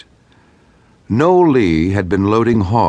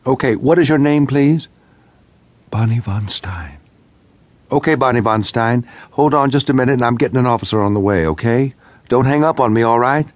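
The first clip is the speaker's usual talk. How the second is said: higher, brisk, firm dispatcher voice; urgent dispatcher; 6 semitones higher than usual. A narrator's reading. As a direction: keep her on the line, urgent but steady. Dispatcher voice held.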